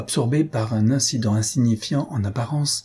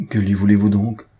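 louder, sharp demand; wife demanding abruptly of the sergeant, louder; abrupt cry; 5 dB louder than usual. A narrator's reading. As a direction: fire the question out abruptly. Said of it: "s'écria brusquement Albina".

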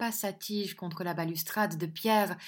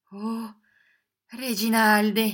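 pained murmur; 3 semitones higher, 9 dB louder, slower than usual. A narrator's reading. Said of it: "murmura-t-elle ... avec douleur".

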